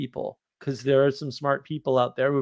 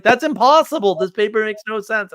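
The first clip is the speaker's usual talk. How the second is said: higher, louder mock outrage; outraged buddy; 8 semitones higher, 6 dB louder than usual. Math buddy scolding him over a bad paper, higher mock outrage. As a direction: play the friend throwing up his hands at the bad paper. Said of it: "And he's like".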